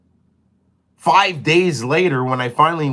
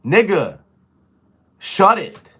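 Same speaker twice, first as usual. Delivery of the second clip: louder, higher snap outside; yelling at dog; 5 semitones higher, 6 dB louder, slower than usual. Real, not acted. Telling the yelping dog outside to shut it, loud yell.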